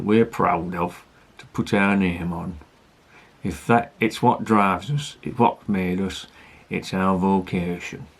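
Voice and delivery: slow, hammed-up Yorkshire accent; bad Yorkshire accent. Beer reviewer reading out the brewery's mission, mock Yorkshire accent. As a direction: read it slowly in a badly faked Yorkshire accent. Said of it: "reading this in a Yorkshire accent".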